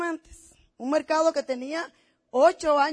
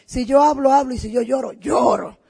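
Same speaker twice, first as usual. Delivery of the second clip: emphatic, in a big way; 7 dB louder than usual. Real, not acted. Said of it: "todo lo mío es en grande".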